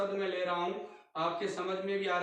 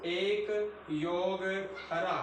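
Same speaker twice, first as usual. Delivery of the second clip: एक योग drawn out very slowly; slow dictation; much slower than usual. Shown as a demonstration. Teacher breaking a word into parts for students, very slow. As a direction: spell it out word by word. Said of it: "dictating morphemes".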